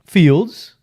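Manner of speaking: loud mocking examiner; mock him sharply and loudly; sarcastic consultant. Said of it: "said, um".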